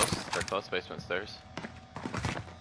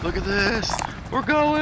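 excited yell; 10 semitones higher, slower than usual. Retold or imitated. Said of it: "I was like".

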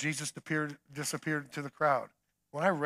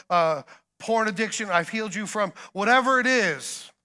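building preacher fervor; 5 semitones higher, 6 dB louder than usual. Acted. Preaching heated list of healings.

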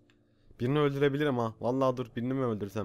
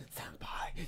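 very soft breath-only longing call; hushed, voiceless murmur; whisper; 13 dB quieter than usual. Acted. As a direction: whisper the name as if dreaming. Acting Yandere's dream.

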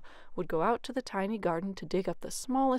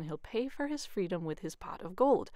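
ordinary delivery, nothing marked

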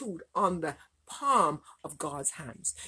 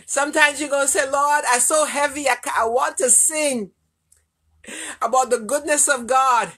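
louder, driving prayer at full voice; impassioned sermon cry, louder and fast; fervent preaching; 4 semitones higher, 3 dB louder than usual. Acted. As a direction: push it like a preacher building to a peak.

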